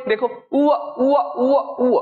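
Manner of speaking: उ stretched and repeated, gliding toward व; sounding out उ. Shown as a demonstration. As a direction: drill the vowel उ aloud for the class. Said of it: "अब आप बोलेंगे उ और अ बोलिए".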